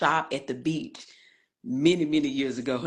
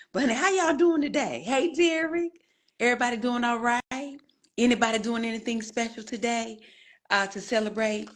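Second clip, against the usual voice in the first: much higher greeting; sing-song greeting; 8 semitones higher than usual. Real, not acted. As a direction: sing up the hello to viewers. Host greets arriving viewers.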